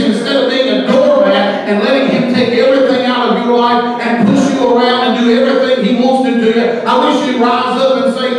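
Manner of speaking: loud, driving sermon voice, building; fiery preaching. Acted. Preacher urging the congregation to fight back, shouting. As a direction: preach it hard and defiant.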